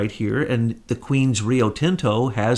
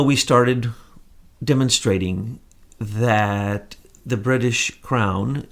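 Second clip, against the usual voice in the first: normal talking voice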